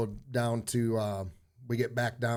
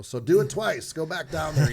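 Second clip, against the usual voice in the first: higher, louder barked order, retold; mock drill instructor; 6 semitones higher, 8 dB louder than usual. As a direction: imitate the drill sergeant's order, half-joking. Drill instructor quoted yelling.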